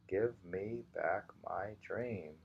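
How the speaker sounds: usual speaking voice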